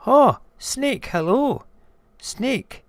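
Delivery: high, loud hail; high mouse voice, hallooing; calling out greeting. Acted. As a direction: call brightly across to a passing creature. Mouse hails the snake.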